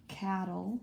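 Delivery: cattle with CAT stressed; stress on first syllable. Shown as a demonstration. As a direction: lean on the first part of cattle. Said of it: "the emphasis is on the double T".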